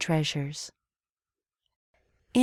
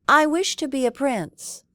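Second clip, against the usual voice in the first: commanding; 8 semitones higher, 3 dB louder than usual. A narrator's reading. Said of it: "Aladdin commanded the genie".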